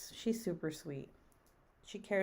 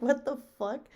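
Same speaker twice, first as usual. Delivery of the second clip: mock shock; 6 semitones higher, 4 dB louder than usual. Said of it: "And I'm like".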